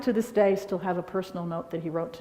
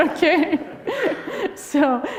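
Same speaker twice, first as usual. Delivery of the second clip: laughing; 8 semitones higher, 7 dB louder, much slower than usual. Real, not acted.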